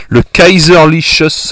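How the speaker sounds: slow, mock German accent; a slow, put-on German accent, apologising for it. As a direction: lean into a hammed-up German accent on Kaiserliches. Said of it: "excusez-moi pour l'accent allemand".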